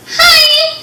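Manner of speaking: put-on high squeaky boy calling out; squeaky shout. A narrator's reading. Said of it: "the squeaky voice shouted".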